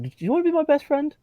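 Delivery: high, quick hopeful squeal; excited pleading. Acted. Lonely character asking for a first best friend, excited plea. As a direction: rush it out, pitch soaring with hope.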